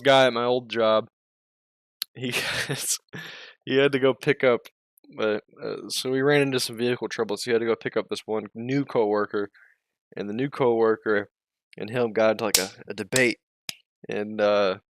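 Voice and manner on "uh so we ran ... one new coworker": ordinary talk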